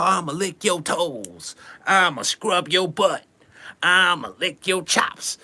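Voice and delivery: slow sleazy come-on voice; corny pickup lines. Retold or imitated. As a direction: lay the cheesy lines on thick. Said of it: "dudes be saying so much of the corniest stuff".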